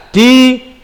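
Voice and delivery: deliberate chant-along term; leading in unison. Shown as a demonstration. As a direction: count the class into each syllable. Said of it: "Di bareng-bareng".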